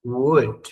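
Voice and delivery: Would with silent L and a final D; model pronunciation. Shown as a demonstration. Teacher models Would for students, clear and slow. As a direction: say Would as wud, ending on the D. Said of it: "Vamos a pronunciar esta palabra".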